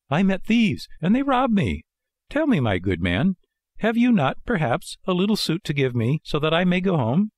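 high puppet voice, asking a favor; pleading puppet Pinocchio. Acted. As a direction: explain and ask for help in a high voice. Pinocchio asks for clothes.

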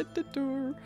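ordinary talk

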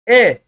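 a short, loud E sound said alone; vowel drill. Shown as a demonstration. Teacher starting the reading drill, a loud clear E. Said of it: "Bakın elif demiyoruz artık".

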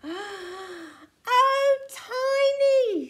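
high, loud, drawn-out dismay; mum's long, dismayed wail; exasperated sigh. A narrator's reading. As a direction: sigh the name in big exasperation. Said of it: "sighed his mum".

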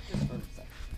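quick unvoiced whisper. Real, not acted. Whispering to a viewer while mom vacuums. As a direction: breathe it with no voice.

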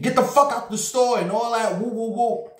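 high-pitched scolding yell; mock angry manager. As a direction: chase the kids out of the store. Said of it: "He was cursing little motherfuckers out".

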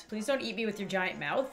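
ordinary talk, nothing marked

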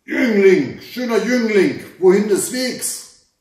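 loud, high menacing challenge; terrible shouting voice. A narrator's reading. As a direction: shout down a stranger as the frightening masked hunter. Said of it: "schrie sie mit schrecklicher Stimme an". (laut und schrecklich angeschrien)

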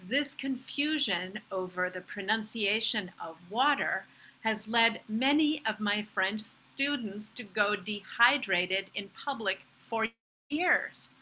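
ordinary talk, nothing marked

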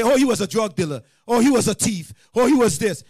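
high, loud mock justification; loud accusing. Preacher mimics a killer justifying himself, loud accusing. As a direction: throw out each excuse forcefully. Acted accuser making excuses.

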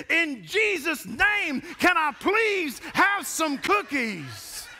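put-on high, slow, drawn-out child's prayer cry; loud child plea. Boy standing in the cart pleading to Jesus, slow and high. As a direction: beg the whole store like a little boy. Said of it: "with the voice as loud as he could muster".

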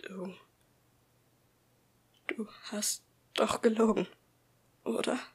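hushed, frightened whisper. A narrator's reading. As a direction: whisper it, scared of the answer. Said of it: "flüsterte Tim auf einmal".

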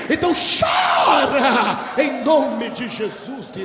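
slow, loud, drawn-out shout; full-force preaching shout; preacher's exhortation. Real, not acted. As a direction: cry out the command to the congregation. Preacher commands congregation to weep.

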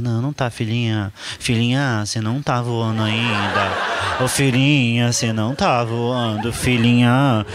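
put-on low, slow, sweet coaxing to her child; mock soothing mother. Mother calming her daughter on the plane, low sweet coo. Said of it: "Aí a mãe ficava".

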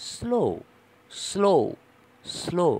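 "স্লো" with strongly hissed s; high, separate repeats of "স্লো", hiss up front; voiceless s demo. Shown as a demonstration. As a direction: drill "স্লো", s all air before the voice starts. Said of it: "এসের সাউন্ডটা কিন্তু".

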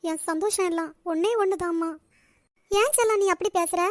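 usual speaking voice

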